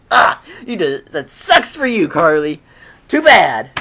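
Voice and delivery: loud mock scolding; unsympathetic taunt. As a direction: jeer at her bad luck. Mock reply to the stuck character.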